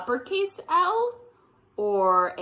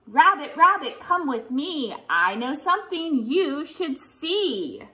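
sing-song read-aloud voice, higher; reading to children; 5 semitones higher, 5 dB louder than usual. Acted. Teacher reads the picture book refrain to kids, sing-song.

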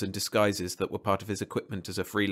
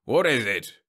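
icy; 5 dB louder than usual. A narrator's reading. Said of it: "Durden looked icily at Jeb".

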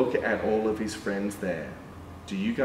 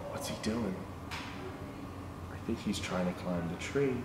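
hushed suspense; 5 semitones lower, 11 dB quieter than usual. Pretend. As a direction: lean in and hush the kids. Suspense before tree climb.

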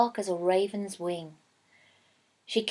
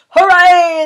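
loud high "Hooray!"; loud crowd cheer; 6 semitones higher, 12 dB louder than usual. A narrator's reading. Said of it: "they cried".

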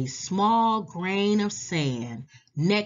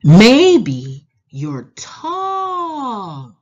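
slow, stretched and louder phrase start; drawn-out reading; 4 semitones higher, 13 dB louder, much slower than usual. A narrator's reading. Reading a picture book to children, slow and stretched. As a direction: stretch tall out long and high.

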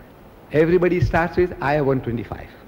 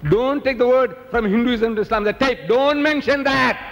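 high, insistent protest; indignant order; 7 semitones higher than usual. Acted. Speaker forbidding mention of his tape, high indignant order. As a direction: forbid it in a raised pitch, stretching the end.

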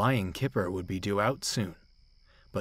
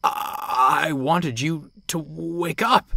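nervous stammer, raised pitch; 7 semitones higher, slower than usual. A narrator's reading. Bear explains himself to angry Dudley, stammering. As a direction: stumble over the start, flustered. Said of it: "stammered Bear".

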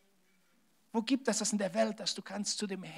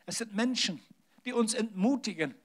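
usual speaking voice

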